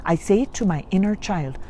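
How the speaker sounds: ordinary talk, nothing marked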